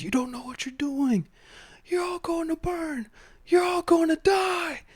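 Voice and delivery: very high, strained mock scream held back in volume; frantic Maul. Captured villain warning his captors of doom, imitated frantic scream.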